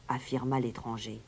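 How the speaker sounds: usual speaking voice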